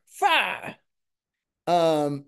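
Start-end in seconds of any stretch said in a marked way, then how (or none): 0.0-0.8 short, high-pitched rock-show cry; mock battle cry. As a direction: call it out like a cannon command. Shouts a rock catchphrase as title.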